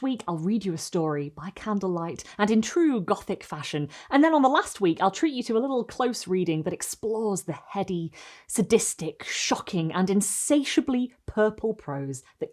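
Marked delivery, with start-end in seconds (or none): none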